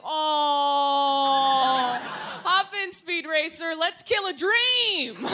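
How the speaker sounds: loud, slow sarcastic cheer; mock enthusiasm, dragging the last line. Mocking her boyfriend's car plan, loud sarcastic cheer. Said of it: "And I was like".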